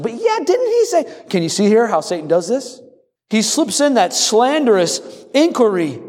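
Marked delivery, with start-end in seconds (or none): 0.0-1.2 high, sly, wheedling tempter voice, much higher; sly serpent. Acted. Preacher playing the serpent tempting Eve, sly and high. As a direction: coax like a snake planting doubt.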